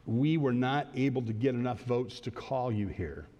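low, subdued retelling of bad news; mildly downcast, hesitant delivery; reluctant bad news. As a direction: break it gently, a little low. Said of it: "he had a funny sound in his voice".

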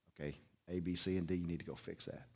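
quiet authority, no fuss at all; calm boss. Retold or imitated. Boss giving calm orders.

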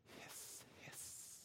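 soft voiceless snake hiss; breathy imitated hiss. Snake hiss between taunts.

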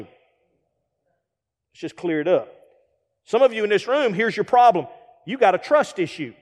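ordinary delivery

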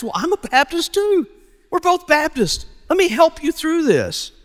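very high, eager acted voice; excited bonding. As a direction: pile on agreement in a high, thrilled voice. Acted men delighted at shared denomination.

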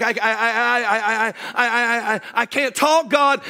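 lightly voiced stammer on repeated I; a hint of Moses stammering his excuse; stuttering Moses. Moses stammering his excuse to God, mild mock stutter. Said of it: "he's stuttering".

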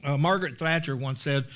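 ordinary delivery

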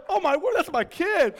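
loud, high re-enacted exclamation; alarmed realization. Dad suddenly noticing the crying child is his, loud high alarm. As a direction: blurt out a sudden shocked discovery. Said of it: "And then all of a sudden".

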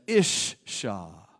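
Ishsha said low as Hebrew; Hebrew word. Shown as a demonstration. Preacher giving the Hebrew word, low and careful. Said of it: "in the Hebrew".

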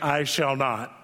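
put-on low, stubborn teenage refusal; a put-on lofty refusal; defiant teen. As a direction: dig in like a sulky teenager.

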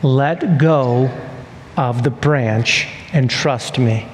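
low, deliberate repeat with pauses; voice of God. Acted. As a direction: spell out the order gravely, phrase by phrase. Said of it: "God says it again".

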